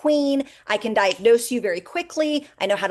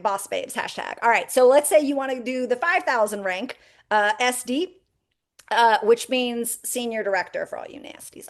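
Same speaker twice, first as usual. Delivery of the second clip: ordinary talk, nothing marked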